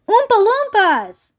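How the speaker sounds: high, drawn-out cry of amazement; storybook chorus of surprise; astonished group exclamation. A narrator's reading. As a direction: exclaim it slowly and high, as a whole crowd. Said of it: "everyone said at once".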